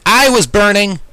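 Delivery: high, loud alarmed cry; panicked shout. Acted. Narrator realizing his fur is burning, loud panic.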